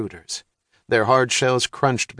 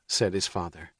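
plain reading voice

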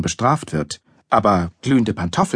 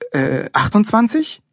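high, slow, rising question; hesitant guess; 10 semitones higher than usual. A narrator's reading. Boy guessing for his father, hesitant. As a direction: feel your way to an answer.

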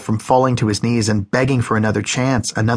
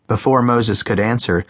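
ordinary delivery, nothing marked

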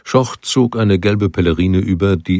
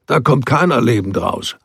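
higher, raised warning over the water; calling a warning; 5 semitones higher than usual. A narrator's reading. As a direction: call out the grim warning. Schoch warning the strangers.

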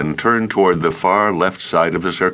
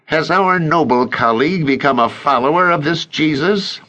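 higher, insinuating elder; sneering Pharisee; 9 semitones higher than usual. A narrator's reading. Azariah insinuating Joseph follows Jesus, high and sly. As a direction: slip in the accusation with a sneer.

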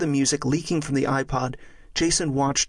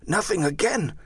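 put-on higher, stunned young friar; dismayed disbelief; 5 semitones higher than usual. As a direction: voice your dismay, pitched up. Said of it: "falling to his knees".

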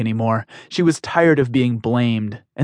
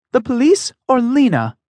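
higher, louder pleading defiance; a high, firm young heroine standing up; defiant heroine voice; 12 semitones higher, 4 dB louder than usual. Acted. Emmy shot back.

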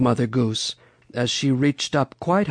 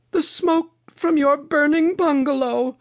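Mother Goose. A narrator's reading. Mother Goose reveals the fire.

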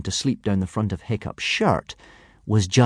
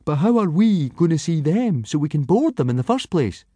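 high-voiced boy pressing his point; Fishlegs; 8 semitones higher than usual. A narrator's reading. Skinny boy questioning his teacher's plan, high and reasoning.

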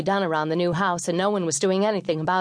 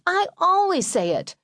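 put-on very high, insistent kid voice; squabbling child; 12 semitones higher than usual. A narrator's reading. Brother claiming credit for grace at dinner, insistent. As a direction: whine it high like a squabbling boy.

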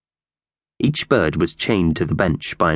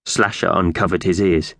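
plain reading voice

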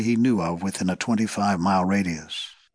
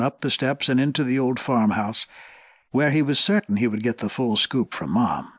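usual speaking voice

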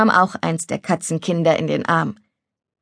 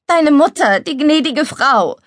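high, louder anxious warning; worried appeal, pitched up; pleading; 8 semitones higher, 4 dB louder than usual. Acted. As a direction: warn him urgently about who might see. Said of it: "klang auf einmal flehentlich".

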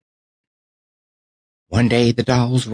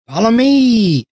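much higher, louder rallying call; cry to follow; 14 semitones higher, 5 dB louder, slower than usual. Acted. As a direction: lead the charge. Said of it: "she cried".

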